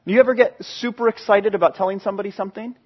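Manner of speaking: ordinary talk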